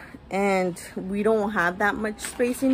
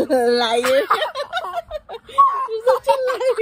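laughing; 12 semitones higher, 4 dB louder than usual. Real, not acted. Laughing at child's fib.

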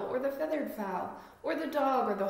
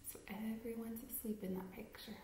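hushed whisper so nobody wakes; 12 dB quieter, faster than usual. Acted. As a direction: whisper it like the animals are sleeping. Aside while story characters sleep.

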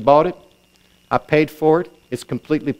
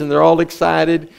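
ordinary delivery

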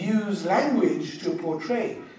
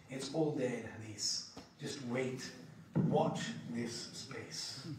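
teasing hush; 7 semitones lower, 13 dB quieter, slower than usual. Retold or imitated. Teasing reply to questioner.

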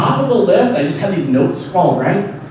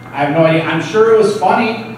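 ordinary delivery

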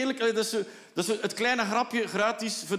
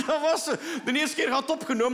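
usual speaking voice

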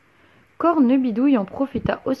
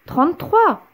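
much higher, louder exasperated repeat; annoyed doctor insisting; irritated doctor; 7 semitones higher, 5 dB louder than usual. A narrator's reading. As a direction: lose patience on "Trente-trois". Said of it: "s'énerva le docteur".

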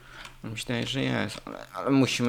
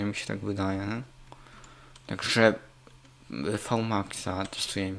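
ordinary delivery

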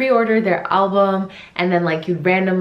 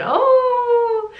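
tearful cry. Real, not acted. Watching idol, overcome.

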